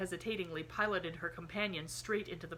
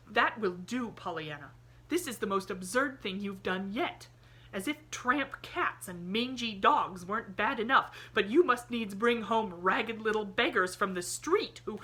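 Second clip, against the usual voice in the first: a stern aunt, louder; stern scolding; 4 semitones higher, 7 dB louder than usual. A narrator's reading. Aunt Polly scolding Pollyanna for bringing a beggar. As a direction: put her foot down.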